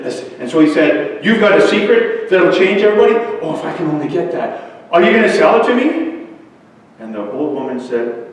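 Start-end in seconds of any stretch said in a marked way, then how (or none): 1.2-6.3 eager, high and loud, rising into the question; excited boy. Acted. Ryan to the old woman about buying her secret, excited. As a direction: blurt it out with greedy hope.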